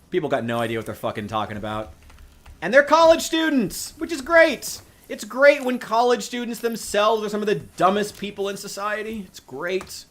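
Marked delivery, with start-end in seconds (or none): 2.6-10.0 sarcastic. Acted. Sarcastic praise of dumb students.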